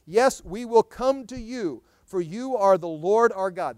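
drawn-out, loud scripture; solemn proclaiming read; slow emphatic reading. Acted. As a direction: lean on every word.

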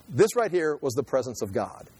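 ordinary delivery

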